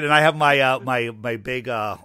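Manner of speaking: ordinary delivery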